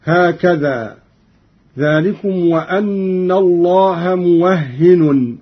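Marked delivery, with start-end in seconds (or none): 1.8-5.4 موهن as muwahhinun, doubled h; slow recitation, موهن with open wa and final -un; Qalun reading. Shown as a demonstration. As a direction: say موهن as mu-wah-hi-nun. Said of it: "بفتح الواو وتشديد الهاء وتنوين النون".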